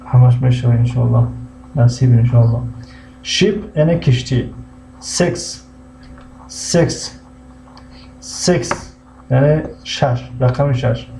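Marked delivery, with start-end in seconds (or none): 5.0-9.0 "سکس" whispered as English six, higher; breathy demo. Shown as a demonstration. Teacher models "سکس" in a soft breathy voice. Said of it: "teacher models English word".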